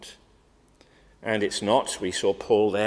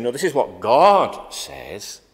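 slow, louder, standing firm; firm refusal; 2 semitones higher, 10 dB louder, slower than usual. Retold or imitated. Preacher voicing what Aaron should have said, firm and louder. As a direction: plant your feet and refuse.

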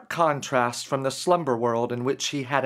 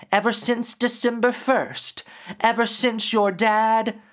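gentle grief creature; 5 semitones higher than usual. Acted. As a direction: speak as a patient spirit revealing itself. Creature speaks to Derek.

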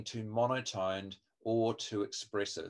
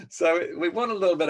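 laughing; 7 semitones higher, 7 dB louder than usual. Real, not acted. Laughs while speaking.

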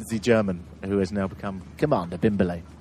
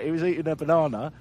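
usual speaking voice

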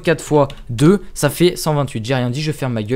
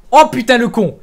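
excited exclaim; 7 semitones higher, 6 dB louder than usual. Real, not acted. Realization of riddle.

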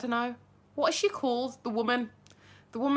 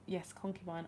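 ordinary delivery

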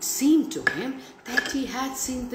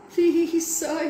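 whispered plea; 9 semitones higher, 4 dB louder than usual. A narrator's reading. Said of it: "he whispered as the gentleman".

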